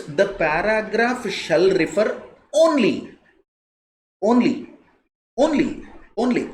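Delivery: only hammered again and again; stress on only. Shown as a demonstration. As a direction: lean hard on each only.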